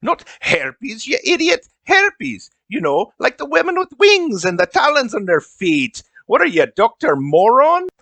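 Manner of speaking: put-on high, indignant accented scolding; exasperated elf. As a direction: berate them as an accented elf. Elf berates host who misheard.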